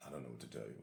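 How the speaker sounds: very low, hushed, helpless reply; low on purpose. Retold or imitated. Boy answering his scolding schoolmate, low and very soft. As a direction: sink right down deep and quiet. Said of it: "low and low on purpose".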